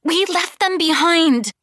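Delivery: put-on high, loud childlike protest; girl's distressed high outcry; upset young girl. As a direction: cry out like a desperate child. Upset twelve-year-old girl protesting.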